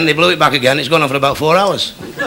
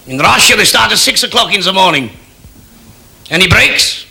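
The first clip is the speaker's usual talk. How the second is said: higher, forceful boasting voice; demanding Soviet leader; 4 semitones higher than usual. Acted. Kosygin compares factory hours with Russia's, forceful boasting. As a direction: declare it proudly, then fire off a question.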